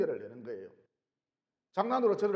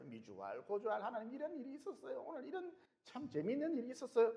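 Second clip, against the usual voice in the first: hushed, chatty confiding to God; soft intimate prayer; 5 semitones higher, 9 dB quieter than usual. Retold or imitated. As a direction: tell it softly like a secret.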